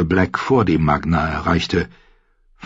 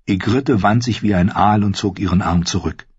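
ordinary talk

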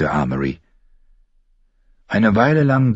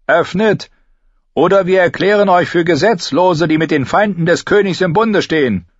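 higher, forceful knight's voice; high-pitched, imperious soldier's call; commanding knight; 11 semitones higher, 5 dB louder than usual. A narrator's reading. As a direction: declare it like an armed knight. Knight threatens the gate's defenders.

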